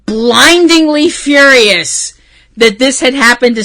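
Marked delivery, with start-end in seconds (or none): 0.0-2.1 blindingly furious drawn out slowly, higher and louder; slowly spelling out how mad she was; slow emphatic fury. Real, not acted. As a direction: stretch out blindingly furious word by word. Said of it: "I was so".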